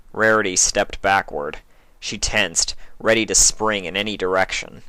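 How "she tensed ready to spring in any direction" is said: plain reading voice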